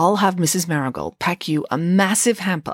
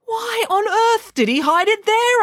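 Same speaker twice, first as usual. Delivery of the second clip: high, slow, louder baffled headmaster; 3 dB louder than usual. A narrator's reading. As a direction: ask in disbelief. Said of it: "asked the headmaster".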